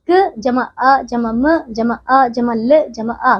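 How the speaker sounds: slow, each sound of कमल said apart with a pause; slow sound-by-sound spelling. Shown as a demonstration. Teacher breaking कमल into sounds slowly for children. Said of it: "इसी प्रकार कमल में".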